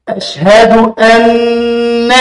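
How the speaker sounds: أن held with a two-beat nasal nun; أن with ghunna for two beats; doubled nun. Shown as a demonstration. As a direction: chant أشهد أن slowly, holding the nun. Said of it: "نون مشددة".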